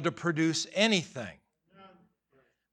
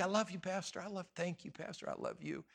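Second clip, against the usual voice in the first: mock sweet; 16 dB quieter than usual. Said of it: "She tells me every week".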